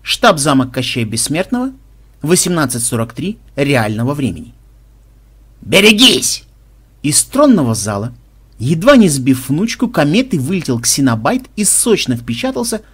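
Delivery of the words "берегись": loud, high-pitched alarm; warning shout. A narrator's reading. Someone warning others as Ksenobait flies out, in a raised shout. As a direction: call out sharply to clear the way. Warning as players flee Koschei.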